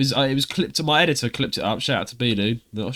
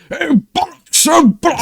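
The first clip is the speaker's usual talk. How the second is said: much higher, louder comic British exclaim; imitating Chris's voices; 14 semitones higher, 8 dB louder than usual. Imitating Chris voicing audiobook characters, high comic cry. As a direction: ham up a cartoon character. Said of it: "I like how he does that".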